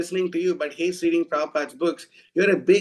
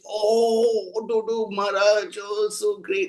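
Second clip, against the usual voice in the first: higher, gushing mock adoration of Maharaj; mock fawning devotees; 5 semitones higher, slower than usual. Mocking devotees who praise the bogus sannyasi, gushing. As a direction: lightly imitate starry-eyed followers.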